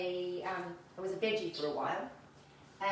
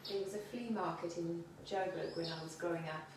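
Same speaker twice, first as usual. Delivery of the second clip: real soft, low-key reminiscing; speaking softer; 3 semitones lower, 9 dB quieter than usual. Real, not acted. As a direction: trail softly into a memory, voice dropping away. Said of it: "you might need to speak up a little bit".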